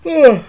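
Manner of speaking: loud high disgusted groan; frustrated groan. Real, not acted. Player groaning at his own mistake, loud and disgusted. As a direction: let out a sharp fed-up ugh.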